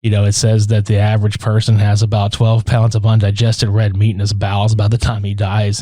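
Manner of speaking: low, calm, even monotone; Judge Reinhold. Acted. Co-host quoting a cop to Taggart, unruffled calm. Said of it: "he's very calm and he's just like".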